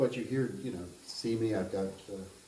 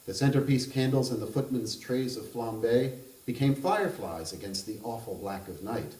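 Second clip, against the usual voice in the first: measured reading of a literary novel aloud; even, unhurried narrator voice; story narration; 3 semitones higher, 4 dB louder than usual. A narrator's reading. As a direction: read it like an author at a book event.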